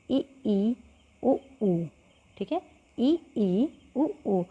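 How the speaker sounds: ई ई उ ऊ with mouth nearly closed; vowel demonstration. Shown as a demonstration. Teacher demonstrates close vowels, nearly closed mouth. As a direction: sound out the close vowels clearly. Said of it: "जैसे कि".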